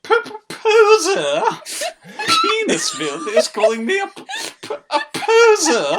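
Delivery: high, loud sputtering snob; outraged posh stammer. Acted. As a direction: splutter it in disbelief. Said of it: "we picked the right voice".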